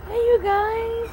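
high, loud happy hello; excited greeting. Real, not acted. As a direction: greet them with delight. Greeting kids off bus.

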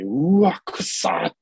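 high disgusted exclamation; disgusted yelp. Retold or imitated. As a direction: recoil at a bad smell. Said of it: "とか言ってて".

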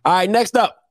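ordinary talk, nothing marked

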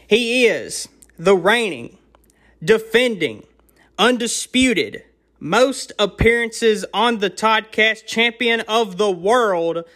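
booming ring announcer, drawn out. Acted. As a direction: introduce him like a title fight. Boxing-style champion introduction.

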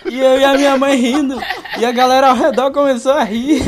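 high, loud, laughing through the words; can barely speak for laughing; real laughter in the voice. Real, not acted. Telling while laughing.

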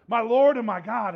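full-throated, loud cry of faith; shouted exclamation. Retold or imitated. Thomas shouting to the risen Jesus, loud exclamation. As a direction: shout it at the top of your lungs. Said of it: "saying it at the top of his lungs".

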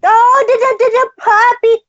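high doting coo for a dog. Real, not acted. Cooing to a puppy.

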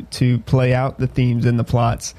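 normal talking voice

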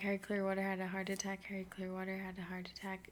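fading into a hushed whisper. Shown as a demonstration. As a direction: let it drop to a whisper. Tongue twister repeated, fading.

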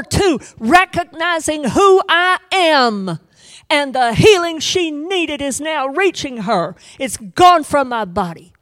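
high, loud startled exclamation; Jesus startled. Acted. Jesus feeling the touch, loud and startled. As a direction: exclaim that power has left you. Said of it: "And he said".

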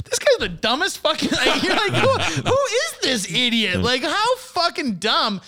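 mocking through laughter. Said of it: "looks at the other guy and goes".

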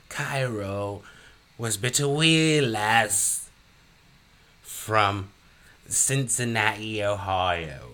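put-on slow, high Irish storyteller accent; hammy leprechaun Irish lilt, drawn out. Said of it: "My, my accent's changing".

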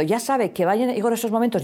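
ordinary talk

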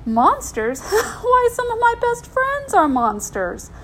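high-pitched witchy amusement; big laugh. A narrator's reading. Godmother to scared girl about monsters, laughing. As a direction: laugh off the very idea. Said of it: "With a big laugh".

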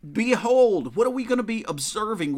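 behold called out loud; proclaiming behold. Shown as a demonstration. Preacher calling his church to look, loud. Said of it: "This is the concept".

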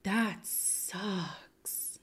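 soft sympathetic whisper. Real, not acted. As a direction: whisper it with pity.

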